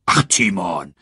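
tiefere, gemütliche Warzenschweinstimme; gutmütig abwinkendes Warzenschwein; schnaubend. A narrator's reading. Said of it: "schnaubte er". (leicht gespielter Pumba)

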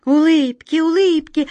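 high, loud, carefree echo; carefree airy voice. Acted. Flying voice picking up the secret, carefree. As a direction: sing it out lightheartedly. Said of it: "подхватил чей-то беспечный летучий голос".

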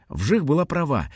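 ordinary delivery, nothing marked

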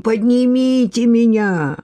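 slow, high, drawn-out groan; moaning. A narrator's reading. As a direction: plead in a stretched, pained moan. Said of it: "простонал специалист".

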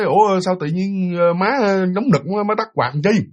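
slow, drowsy half-awake voice; sleepy question. Retold or imitated. Woken by the fan, sleepily asking mom why she switched it off. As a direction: mumble it as if just woken.

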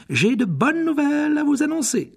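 voix aiguë et forte d'annonce; professeure qui annonce. Acted. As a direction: lancer la nouvelle à toute la salle. Annonce à la grande salle. (annonce solennelle)

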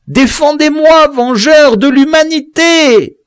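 loud, very high, slow cry for help; a dying man's last call for rescue; desperate appeal. A narrator's reading. The victim cries to Biassou for help, high and desperate.

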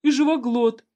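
high tearful pleading; almost crying, blurt it out; near tears. A narrator's reading. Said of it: "чуть не плача бормотал".

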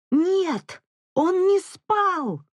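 very high, drawn-out furious cry; slow, high outburst of rage; hysterical roar. A narrator's reading. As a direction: scream it on the edge of hysteria. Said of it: "взревела она уже на грани истерики".

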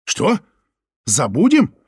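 outraged disbelief; indignant. Acted. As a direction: fire back in disbelief. Лёня outraged at Соня.

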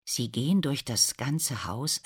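ordinary delivery

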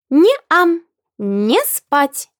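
put-on high, squeaky little girl protest; shrill child voice. A narrator's reading. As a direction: pipe it up like a stubborn toddler. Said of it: "воскликнула Агата пронзительным голосочком". (пронзительный детский голосок)

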